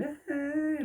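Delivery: high, taunting "ah" laugh; a sister's gloating cackle, high-pitched; mocking laugh. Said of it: "laughing at me".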